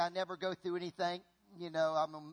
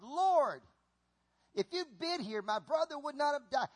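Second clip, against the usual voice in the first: higher-pitched mild reproach; lightly acted blame; 6 semitones higher than usual. Martha to the Lord after her brother's death, lightly acted reproach. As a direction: plead with a touch of accusation. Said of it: "she started blaming the Lord".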